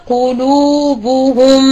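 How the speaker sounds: قلوبهم said slowly with broken rounding; slow mistaken قلوبهم; wrong way demo. Shown as a demonstration. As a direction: spread the lips midway through قلوبهم. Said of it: "يعطي صوت خاطئ".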